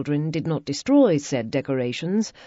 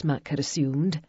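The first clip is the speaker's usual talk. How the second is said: ordinary delivery, nothing marked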